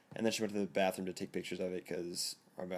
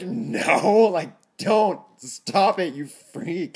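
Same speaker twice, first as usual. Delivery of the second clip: much higher, loud freaked-out yell; panicked shout; 9 semitones higher, 13 dB louder, much slower than usual. Retold or imitated. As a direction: shriek it at her like she scares you. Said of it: "Scared me".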